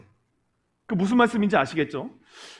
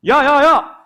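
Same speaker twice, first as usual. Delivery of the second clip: loud, urgent interjection imitating a reprimand; calling out sharply; 4 semitones higher, 6 dB louder, slower than usual. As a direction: cut in loudly to stop them. Voices Jesus stopping the disciples. (큰 소리로 말리는 흉내)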